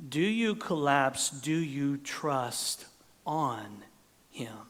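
normal talking voice

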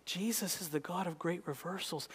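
quiet, intimate echo of the line; hushed. Acted. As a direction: bring it down to a near hush. Hushed repeat of preached line.

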